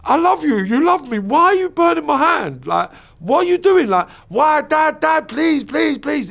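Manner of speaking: high, frantic pleading with a cracking edge; desperate child pleading. Retold or imitated. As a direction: beg your dad to stop like a terrified kid. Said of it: "and I'm thinking".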